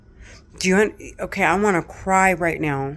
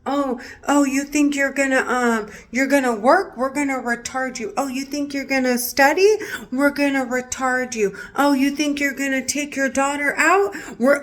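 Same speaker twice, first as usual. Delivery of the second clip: 1 mocking taunting voices; 7 semitones higher than usual. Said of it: "voices in her head taunting".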